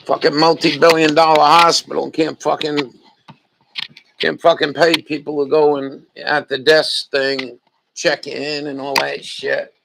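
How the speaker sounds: loud, angry ranting. Real, not acted. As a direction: vent it loudly at the hospital. Ranting about hospital.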